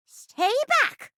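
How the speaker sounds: put-on high, forceful old-lady threat; granny warning. Granny threatens with her sword, high warning. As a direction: warn them off at sword point.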